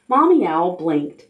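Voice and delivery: ordinary delivery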